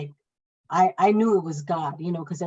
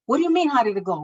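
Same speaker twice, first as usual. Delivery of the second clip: sharp, louder, higher angry reply; angry retort; 5 semitones higher, 5 dB louder, faster than usual. Retold or imitated. Mother snapping at the midwife, angry raised voice. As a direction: snap back at the midwife, raised voice.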